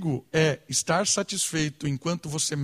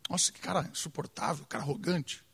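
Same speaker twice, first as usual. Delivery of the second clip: much softer, a muttered inner grumble; muttered private resentment; 3 semitones lower, 9 dB quieter than usual. Retold or imitated. Preacher voices hidden anger at an annoying person, muttered softly. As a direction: mutter it as a secret thought. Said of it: "começa a sentir raiva dela".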